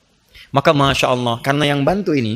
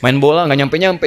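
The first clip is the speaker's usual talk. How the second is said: normal talking voice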